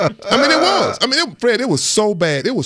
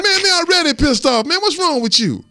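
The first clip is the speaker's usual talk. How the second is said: high, loud exasperated snap; angry retort; 13 semitones higher, 3 dB louder than usual. Retold or imitated. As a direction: fire back at your own teammate. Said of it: "I'm like".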